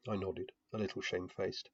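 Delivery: plain reading voice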